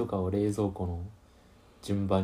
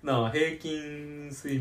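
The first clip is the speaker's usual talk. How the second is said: laughing; 6 semitones higher, slower than usual. Real, not acted. Laughing while answering.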